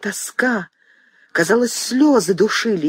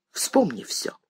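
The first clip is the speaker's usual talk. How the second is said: bitter unvoiced whisper, much softer; 8 dB quieter, slower than usual. Son whispers a bitter reproach to his mother, much softer. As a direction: breathe the words out.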